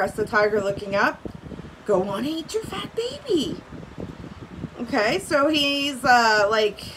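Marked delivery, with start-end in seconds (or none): 1.9-3.6 higher-pitched prodding character voice; urging lion. A narrator's reading. Lion tells tiger to eat baby, goading. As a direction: egg the other one on, pitched up.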